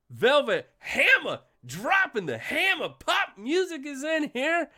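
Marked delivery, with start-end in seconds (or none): none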